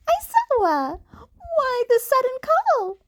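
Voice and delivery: high, chirpy small-animal voice; a bright little principal; Nezu's voice. A narrator's reading. Nezu voiced on phone.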